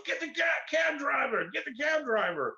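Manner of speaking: high, barking shout of a squad leader; shouted order. Retold or imitated. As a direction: yell the order at the team. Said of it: "the head of the crew goes".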